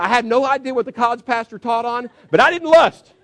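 high and loud, building to a shout; triumphant. Retold or imitated. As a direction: proclaim it like a win. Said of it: "he comes in all fired up".

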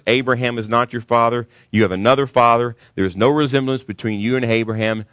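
ordinary talk

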